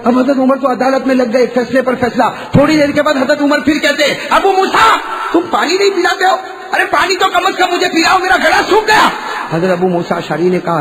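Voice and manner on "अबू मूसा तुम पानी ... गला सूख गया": a caliph calls his companion for water; Umar calling out. Acted. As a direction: call out to Abu Musa, parched.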